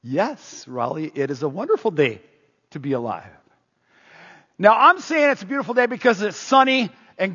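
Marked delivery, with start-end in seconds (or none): 0.0-3.3 put-on lower, softer, calm old neighbor's reply; blind neighbor Dave. Dave answers the pastor at his car window, calm and low. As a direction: answer gently, content with life.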